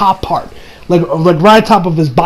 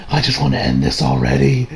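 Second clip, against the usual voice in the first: weary author; 6 semitones lower, 4 dB quieter than usual. Pretend. Imagining the burnt-out author.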